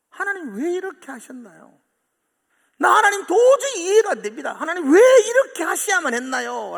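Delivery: loud, high anguished plea; Job crying out. Retold or imitated. Preacher voicing Job crying out to God, loud anguished. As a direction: cry out in bewilderment. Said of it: "부르짖는 욥".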